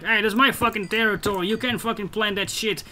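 hammed-up furious neighbor; angry neighbor. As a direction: bark it like a territorial neighbor. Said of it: "But my neighbor was like".

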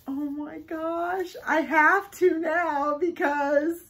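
can't contain the excitement; thrilled squeal. Real, not acted. Discovers Dale plush in box.

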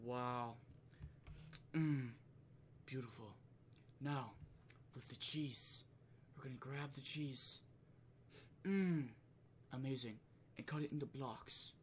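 soft, hushed cooking-show murmur; Gordon Ramsay ASMR. Retold or imitated. Chef admiring his cheese on camera, mock ASMR softness. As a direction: savour every step very softly. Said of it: "A S M R shit".

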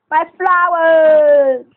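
drawn-out loud wail; dramatic cry. Real, not acted. Player mock-wailing over his flowers, drawn-out shout. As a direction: cry out, stretching the last word.

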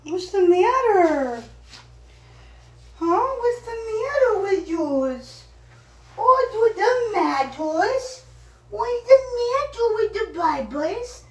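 high sing-song pet voice. Real, not acted. Talking to a pet.

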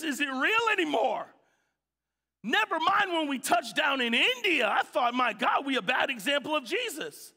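loud, high, fervent preaching; a preacher at full force, fervent and loud. Acted. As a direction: drive it home to the congregation, building.

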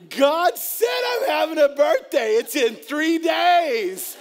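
loud, high, overexcited claim; hammed-up eager believer shouting the news; excited mock shout. Mocking believers' impatient excitement.

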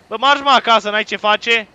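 loud, high scolding shout; angry dismissal. Real, not acted. Player ordering someone home, angry shout. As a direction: yell to chase someone off. Sends game character away.

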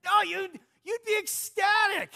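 picture winning and almost passing out; excited. Shown as a demonstration. Preacher to congregation, showing how ecstatic they'd be. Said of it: "there would be an excitement".

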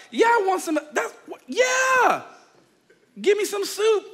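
high-pitched, frantic shouting; hammed-up impatient yell; desperate eagerness. Acted desperately hungry brother answers.

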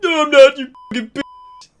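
high, loud outburst of fury; angry shout. A narrator's reading. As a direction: shriek it angrily, high-pitched. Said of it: "he shouted angrily".

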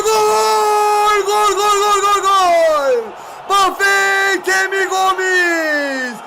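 long drawn-out shouted goal cry; goal call. Real, not acted. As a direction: scream the goal like a commentator. Fan calls a goal.

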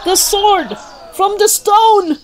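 a spectator spotting the stone sword; amazed onlooker. A narrator's reading. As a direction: gasp it out in wonder. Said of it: "one person says".

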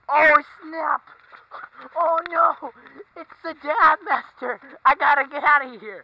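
loud, high hammed-up panic; mock panic. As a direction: yell like you're fleeing a monster.

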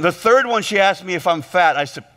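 ordinary talk

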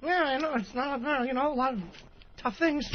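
high, silly puppet voice; squeaky little puppet character; Yogurt Liddy voice. Acted. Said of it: "Liddy. How do you eat".

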